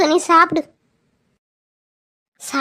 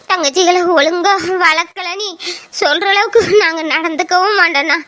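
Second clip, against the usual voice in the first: ordinary talk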